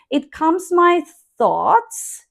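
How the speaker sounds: "It calms my thoughts" slowed, rising on "thoughts"; slow rising phrase. Shown as a demonstration. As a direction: drag it out and lift "thoughts". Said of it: "Here we can also make this rising tone".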